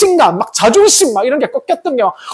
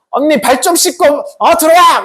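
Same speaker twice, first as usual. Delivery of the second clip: bossy sibling call; 7 semitones higher than usual. Acted. Acted sister snapping at home.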